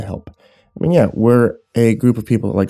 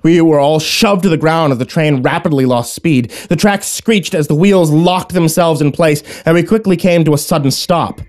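higher, animated storytelling; dramatic story narration; 8 semitones higher, 4 dB louder than usual. A narrator's reading. Narrator describing the train's sudden stop, dramatic narration. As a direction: narrate the sudden danger with urgency.

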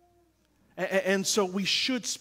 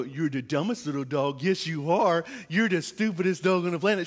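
lovingly cooing insults at a dog; baby talk to a pet, tender tone; sweet voice, harsh words; 4 semitones lower than usual. Shown as a demonstration. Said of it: "she just knows how I'm speaking".

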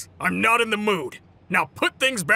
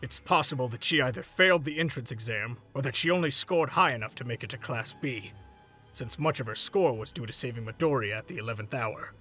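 low, soft, measured reflection; subdued realization; 7 semitones lower, 6 dB quieter than usual. Acted. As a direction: reason it out gravely and quietly. Grave reckoning of what might differ.

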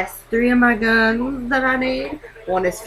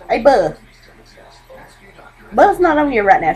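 ordinary talk, nothing marked

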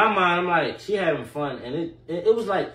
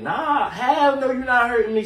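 mock tough; 7 semitones higher, 5 dB louder than usual. Acting tough after being hit.

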